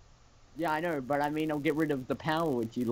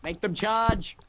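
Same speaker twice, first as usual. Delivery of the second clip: raised, higher commanding call; shouted battle order; 7 semitones higher, 4 dB louder, much slower than usual. Real, not acted. Player ordering troops to charge, calling out high. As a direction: call out the attack.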